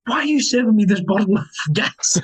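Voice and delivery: high retold disbelief with a laugh; incredulous quote breaking into laughter; astonished. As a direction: imitate a baffled customer, amused. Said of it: "he was astonished".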